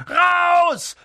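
high, loud furious bellow; roar. A narrator's reading. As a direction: roar them out of the room. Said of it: "brüllte Onkel Vernon".

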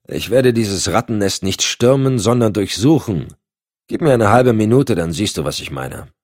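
hard-headed agent brushing off protest; gruff D'Alessio. Acted. D'Alessio entschlossen. (raubeiniger Cop, entschlossen)

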